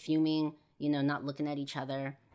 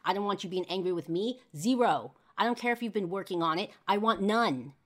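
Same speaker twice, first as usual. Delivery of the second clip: loud, insistent, fed-up tirade; acted angry ultimatum; snapping back; 4 semitones higher, 5 dB louder than usual. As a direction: lay down the law, hammering each demand. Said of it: "he snaps back with".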